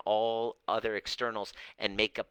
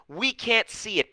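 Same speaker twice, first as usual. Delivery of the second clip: ordinary delivery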